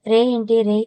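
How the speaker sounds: plain reading voice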